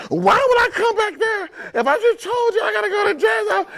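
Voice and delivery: high-pitched mocking wife voice; mimicking wife. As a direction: mimic her annoyed reply, way up high. Husband mimics wife's annoyed reply.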